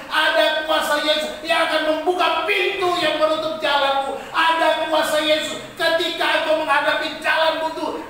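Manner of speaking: loud, high sustained declaration, words stretched out; full-force preaching. Acted. Preacher declaring Jesus' power to the congregation, full-force proclamation.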